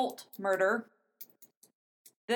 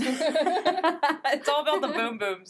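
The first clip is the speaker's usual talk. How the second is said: laughing; 5 semitones higher, 4 dB louder, much slower than usual. Real, not acted. Laughing joke repeat.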